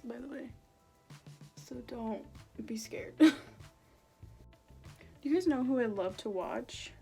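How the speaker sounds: ordinary delivery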